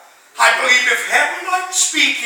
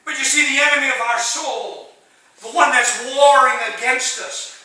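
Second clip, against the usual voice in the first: normal talking voice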